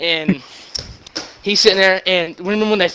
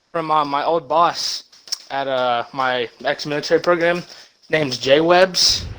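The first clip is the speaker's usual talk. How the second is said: normal talking voice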